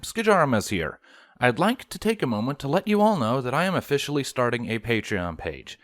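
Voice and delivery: plain reading voice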